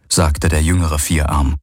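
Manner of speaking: ordinary delivery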